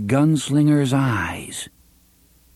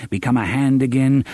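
usual speaking voice

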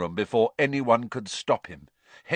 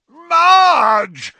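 high, loud, drawn-out cry of the name; horrified yell; 12 dB louder than usual. A narrator's reading. Relatives watching aunt float upward, yelling. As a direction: yell out in alarm. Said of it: "yelled Uncle Vernon and Aunt Petunia together".

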